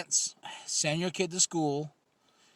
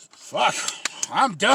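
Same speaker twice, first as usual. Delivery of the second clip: relieved Obama; 6 semitones higher, 8 dB louder, slower than usual. Retold or imitated. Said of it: "He was like this".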